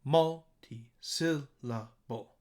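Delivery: Multi-syllable very slowly, MUL, SIL and BLE lifted; three stressed syllables. Shown as a demonstration. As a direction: spread out Multi-syllable, raising first, middle and last beats. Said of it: "three places where my voice goes a little higher".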